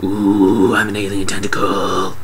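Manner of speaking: drawn-out comic ghostly ooh; spooky alien tentacle. Retold or imitated. Tentacle searching the house, mock spooky. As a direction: play a mock-spooky creature. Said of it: "looking around the house all like".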